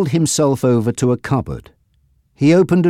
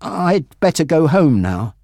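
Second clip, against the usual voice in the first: plain reading voice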